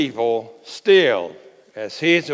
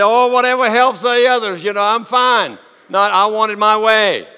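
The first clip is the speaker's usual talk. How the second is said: high, louder, sugary mock-humble voice; sarcastic saintly voice; 8 semitones higher, 8 dB louder than usual. Preacher mocks his congregation in a sugary mock-humble voice. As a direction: sarcastically play the selfless churchgoer. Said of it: "They say".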